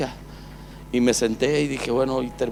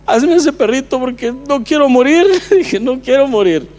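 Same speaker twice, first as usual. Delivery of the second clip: tearful plea; 9 semitones higher, 7 dB louder than usual. Real, not acted. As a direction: voice breaking high and loud with emotion. Reliving desperate plea to God, overcome.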